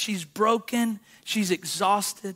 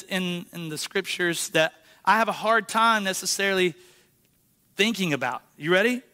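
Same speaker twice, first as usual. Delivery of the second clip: ordinary delivery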